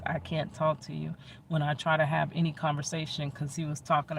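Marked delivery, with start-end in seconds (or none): 0.0-1.1 softly, evenly, well under usual volume; calm low-key voice. Retold or imitated. Telling him I can't talk to you, calm quiet voice. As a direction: say it quietly and without any heat. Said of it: "telling him in this voice".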